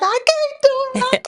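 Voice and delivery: put-on squeaked up high like a crying little kid; high wailing child voice. Girl refusing her mother over the roach, high childish wail. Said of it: "I'm fighting her and crying".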